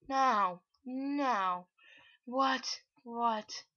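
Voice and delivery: loud, drawn-out repeated cries; dismayed shouting. Real, not acted. Gamer reacting to own misclick, loud dismayed cries. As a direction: shout each word in disbelief. Mishap in game, crying out.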